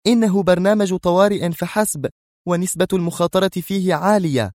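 strict, high-pitched answer; stern. A narrator's reading. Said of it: "قبل أن تجيب في صرامة". (بصرامة)

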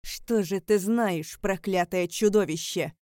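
loud, slow angry challenge. A narrator's reading. Enraged shout at a cursed monster, angry. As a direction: spit it out loudly, with contempt. Father rages at chained Alaric.

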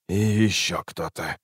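soft, confidential continuation; hushed warning. A narrator's reading. Assassin continues his secret message to the boy, hushed. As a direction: add it quietly, voice held down.

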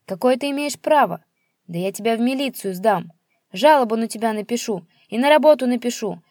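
father yelling threats at his son; furious ranting. Acted. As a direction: rant at him, spitting threats.

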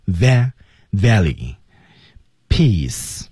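very slow "valley" broken into "va-" then whole; syllable-by-syllable teacher demo; slow pronunciation drill. Shown as a demonstration. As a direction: drag each sound out for learners. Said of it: "四号音".

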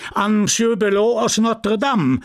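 high-pitched, ringing prize announcement; chairman calling out. Acted. Chairman awards a gold medal to a farmer, calling out. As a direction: proclaim it to a whole crowd.

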